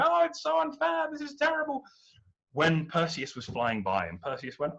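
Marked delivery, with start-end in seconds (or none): 0.0-1.8 high, melodramatic complaint; a damsel overplaying her distress; mock wailing damsel. Andromeda chained to the rock, high mock lament.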